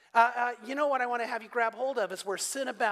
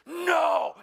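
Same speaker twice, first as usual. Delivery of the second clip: a loud, higher, firm shout; sharp no; 6 semitones higher, 6 dB louder than usual. Retold or imitated. Grandpa disciplining his toddler granddaughter, loud firm shout. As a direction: stop the child short with one loud word. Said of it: "so I said".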